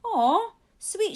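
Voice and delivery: high, loud fond "aw"; touched. A narrator's reading. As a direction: melt at something sweet. Paramedic touched by note.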